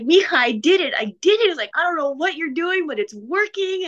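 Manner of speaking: high, excited imitation of her dad; elated dad. Daughter imitating her dad thrilled after his race. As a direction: squeal it like a thrilled father.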